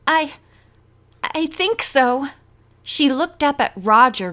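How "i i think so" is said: shaky words through chattering teeth. A narrator's reading. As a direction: brave but shivering, teeth chattering. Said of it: "through chattering teeth".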